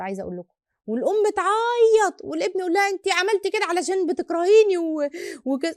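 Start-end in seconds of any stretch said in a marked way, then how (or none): 0.9-2.9 excited retelling. Retold or imitated. Retelling emotional family reconciliation. 2.9-5.7 put-on hurt child accusing. Son accuses crying mother.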